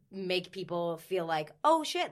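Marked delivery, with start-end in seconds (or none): 1.6-2.1 high, louder pleased exclamation; sudden impressed gasp of words; imitating a client's delighted surprise; amazed.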